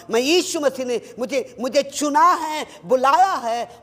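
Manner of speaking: proclaiming. Retold or imitated. Preacher proclaiming his calling.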